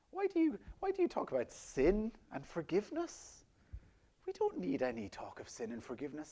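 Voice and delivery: dismissive modern sceptics. Acted. Preacher imitating people who question Jesus today, lightly dismissive. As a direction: put on a mildly scoffing objector's voice. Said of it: "They will say".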